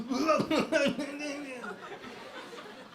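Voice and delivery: mock wailing sob, very high; hammed-up blubbering wail; full blubber. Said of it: "a full blubber".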